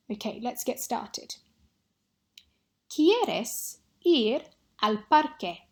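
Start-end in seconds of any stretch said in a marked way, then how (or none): 2.9-5.6 ¿Quieres ir al parque? read slowly and clearly, a bit louder; slow dictation. Shown as a demonstration. Teacher dictates a sentence to students, slow and clear. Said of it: "say one of these six sentences".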